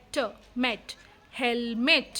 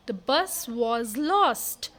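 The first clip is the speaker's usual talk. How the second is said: ordinary delivery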